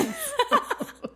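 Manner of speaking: breaks into a real, high-pitched giggle. Real, not acted. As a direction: laugh at yourself. Laughs at her failed impression. (hohes Kichern)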